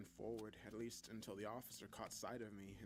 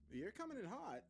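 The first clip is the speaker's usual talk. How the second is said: high-pitched, taken-aback remark; startled roommate; 12 semitones higher than usual. A narrator's reading. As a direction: react to a friend charging in. Roommate startled by his entrance.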